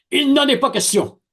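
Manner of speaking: high, loud flat refusal; defiant refusal. Retold or imitated. Student refusing the professor, high and loud defiance.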